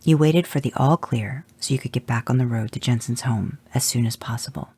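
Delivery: usual speaking voice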